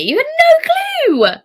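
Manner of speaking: high, slow, drawn-out "no clue"; playful drawn-out protest; mock indignant. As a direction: stretch out "no" in mock outrage.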